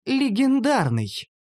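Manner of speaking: high, drawn-out, savoured word; self-satisfied slow elf; smugly pleased. A narrator's reading. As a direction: repeat it slowly with relish. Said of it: "довольно повторила эльфийка".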